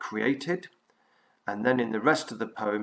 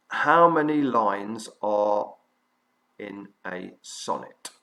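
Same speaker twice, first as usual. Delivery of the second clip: slow, spaced-out question for note-taking; deliberate dictation pace with clear gaps; 7 dB louder, slower than usual. Real, not acted. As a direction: dictate it word by word, unhurried.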